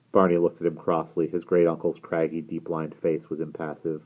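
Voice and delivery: ordinary delivery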